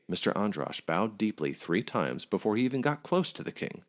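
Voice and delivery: ordinary delivery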